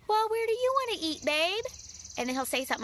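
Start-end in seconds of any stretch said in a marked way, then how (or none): 0.1-1.6 sugary, higher pitched mock sweetness; imitating her own coy question to her husband; fake innocent question. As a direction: play dumb like you don't already know. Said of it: "instead I'm like".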